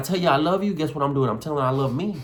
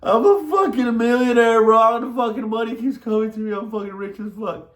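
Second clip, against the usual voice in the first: high, wailing affirmations; hammed-up sobbing boast; mock crying; 8 semitones higher than usual. Shown as a demonstration. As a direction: cry it out in an exaggerated wail. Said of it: "that sad and that crying emotion".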